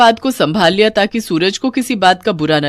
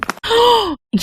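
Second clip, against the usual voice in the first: sharp high gasp; a startled high intake of breath; shocked gasp. Acted. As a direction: gasp in astonishment at the money. Family sees money from hair.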